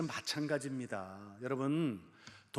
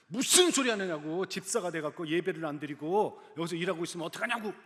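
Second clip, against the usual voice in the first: mock angry scolding; 2 semitones higher, 4 dB louder than usual. Preacher showing how not to scold a lapsed deacon, mock angry. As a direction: play an indignant telling-off. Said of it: "뽀록 화를 내서".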